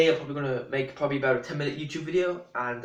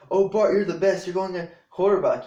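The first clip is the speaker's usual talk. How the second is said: louder, higher mock gushing dad; raised-voice parody of doting praise; Homer Simpson; 4 semitones higher, 6 dB louder than usual. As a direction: imitate a father loudly over-praising his son. Voicing Homer's over-praise of Bart.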